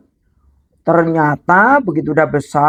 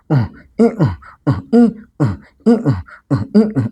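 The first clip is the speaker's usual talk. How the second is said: straining grunts; 3 semitones lower, faster than usual. Acted. Hero straining to carry calf.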